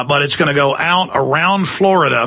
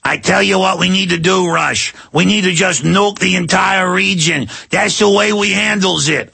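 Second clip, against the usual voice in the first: Yankee voice; 4 semitones higher than usual. Retold or imitated. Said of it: "you know, the good old Yankees".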